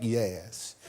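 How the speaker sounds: low, soft Deep South imitation; quiet put-on Mississippi accent. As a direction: answer low in a mimicked Mississippi boy's accent. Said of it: "talk the way he talks".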